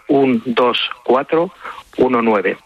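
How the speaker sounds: number read out slowly in groups. Shown as a demonstration. Guest repeats the donation number for radio listeners, slowly. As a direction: spell out the number slowly, pausing between groups. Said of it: "deberíamos decirlo más despacio".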